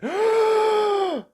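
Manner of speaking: very high sharp gasp of realisation; sudden gasp. Acted. Mister Forgetful suddenly remembering the message, a high gasp. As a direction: gasp as it all comes back.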